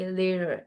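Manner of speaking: LATER low, tongue folded, T turned soft and R-like. Shown as a demonstration. Teacher showing how to fold the tongue, LATER with soft T. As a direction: fold your tongue slightly through LATER, no hard T. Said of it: "थोड़ा सा जुबान को fold करके".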